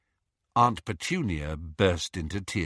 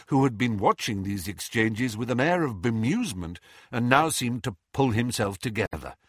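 ordinary delivery, nothing marked

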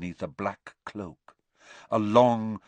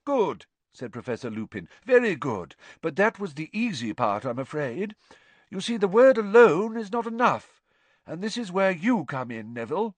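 higher, kindly lecturing professor; Lupin, genial teacher; 9 semitones higher, 4 dB louder than usual. A narrator's reading. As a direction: explain with amused warmth. Lupin praising and explaining.

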